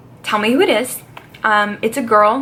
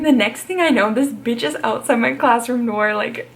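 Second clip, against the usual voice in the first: ordinary delivery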